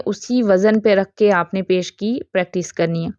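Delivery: usual speaking voice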